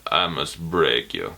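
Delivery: low, slow, quiet deadpan menace; slow, low Drago threat; Ivan Drago. Retold or imitated. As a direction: loom over them like a Russian boxer. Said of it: "I feel like Ivan Drago".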